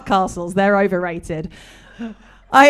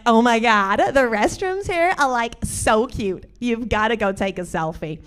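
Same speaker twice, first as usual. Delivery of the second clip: put-on American Valley girl accent. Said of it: "excuse my American accent in advance".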